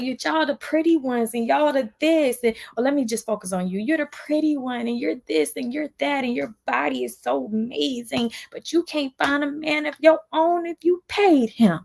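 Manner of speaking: high mocking voice of the rival, dripping fake praise; imitating a catty rival, high and sarcastic; mocking impression. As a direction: sneer it like the catty girl. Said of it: "and then it's like".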